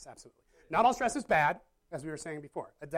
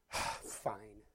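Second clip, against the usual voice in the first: resigned fine; 8 semitones lower, 9 dB quieter than usual. Acted. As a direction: lower and softer, a defeated sigh of a yes.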